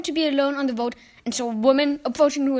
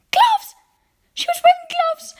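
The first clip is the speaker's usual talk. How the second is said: high, loud burst of alarm; high, loud, frightened realisation; alarmed shout; 10 dB louder than usual. A narrator's reading. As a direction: cry out in sudden fright. Boy realises she's a witch.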